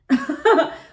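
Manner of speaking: high burst of laughter; loud high laugh. Real, not acted. After mocking her own panic, loud high laugh.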